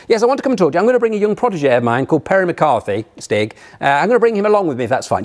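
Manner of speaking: hammed-up upper-class racing driver; plummy English accent. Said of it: "in the style of James Hunt".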